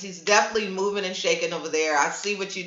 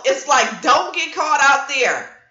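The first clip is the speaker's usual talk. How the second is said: ordinary delivery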